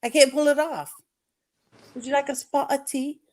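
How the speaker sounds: a hammed-up British tea-time accent. S1 attempts a British accent she says she can't do. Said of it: "If I could do the Australian accent or the British".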